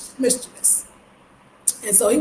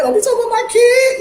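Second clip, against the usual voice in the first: higher, louder mock telling-off; protective mother; 13 semitones higher, 6 dB louder than usual. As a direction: snap like a mother defending her kid.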